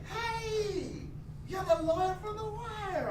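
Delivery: very high, drawn-out squeal of recognition; Con Ed woman. Retold or imitated. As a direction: imitate an excited fan in falsetto. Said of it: "this woman who works for Con Ed".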